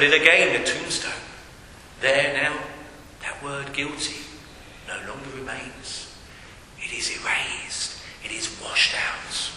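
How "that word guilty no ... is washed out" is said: hushed whisper. Acted. Preacher to congregation on guilt erased, whispered. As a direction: breathe it out like a secret.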